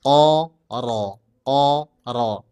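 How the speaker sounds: "Qa" dari pangkal lidah lalu "ro" tebal; pancing "ro" dengan "qa"; qaf then ro. Shown as a demonstration. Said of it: "Pancing pakai huruf qaf". (qa lalu ro tebal)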